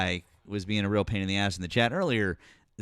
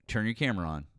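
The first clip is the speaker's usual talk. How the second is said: usual speaking voice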